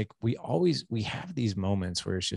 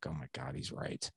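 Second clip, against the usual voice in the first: much softer and lower, realizing to yourself; hushed realization; 8 semitones lower, 14 dB quieter than usual. Retold or imitated. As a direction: say it quietly as it sinks in.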